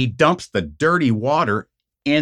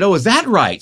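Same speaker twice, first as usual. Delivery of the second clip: mock-challenging, higher and louder; sarcastic retort; 8 semitones higher, 5 dB louder than usual. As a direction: throw it back at him sarcastically. Sarcastic retort in retold quarrel.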